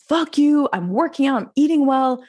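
mock-furious rant, climbing in pitch; retold exasperated outburst. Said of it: "I was like".

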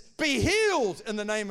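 forceful healing command; loud voice. Acted. Preacher quoting scripture's command to be healed, loud voice. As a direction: proclaim it loudly, leaning on the first words. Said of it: "In a loud voice".

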